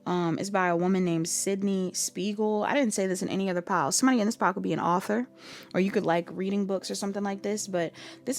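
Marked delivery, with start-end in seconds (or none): none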